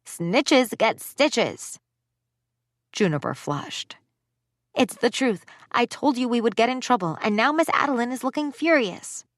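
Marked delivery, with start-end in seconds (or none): 0.0-1.8 put-on slow, sing-song child taunt; taunting kid. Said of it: "one of them complained". 4.7-9.2 put-on higher-pitched defensive girl; a kid insisting she was right; young girl voice. Girl defending herself to teammates.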